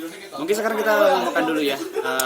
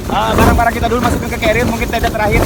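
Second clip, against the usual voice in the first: raised voice over a storm; shouting over wind; 4 semitones higher, 4 dB louder than usual. Real, not acted. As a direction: call out over howling wind. Holding tent in strong wind.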